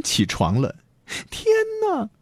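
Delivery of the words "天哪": very high, softer startled cry; small, high cry of shock; scared girl in a storybook; frightened exclamation. A narrator's reading. Daughter sees dog, also frightened.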